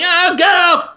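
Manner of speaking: high, loud yelping; mock pained protest. Host voices a netted man prodded with a trident, high yelps. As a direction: yelp like someone being poked. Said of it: "And he's just going".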